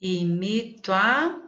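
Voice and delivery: imito a drawn out slowly, beat by beat; teacher scanning syllables slowly; syllable count. Shown as a demonstration. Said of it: "quantas sílabas poéticas terão".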